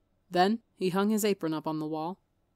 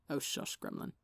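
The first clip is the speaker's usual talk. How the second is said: whispered, much softer hush; hushed shush; 3 semitones lower, 9 dB quieter than usual. Acted. Wilbur hushing Tommy's bragging, soft fond whisper. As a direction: hush him fondly under breath.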